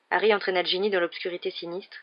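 usual speaking voice

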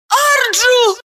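high ringing shout of a name; distant shout. Acted. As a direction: call out a name across the hall. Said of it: "Имя дробило эхо".

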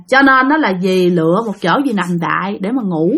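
ordinary delivery

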